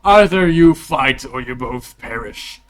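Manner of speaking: slow, loud royal decree; commanding king. Acted. Game master voicing the king's ultimatum, loud and commanding. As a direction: proclaim the threat to the arena. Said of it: "And he gets up".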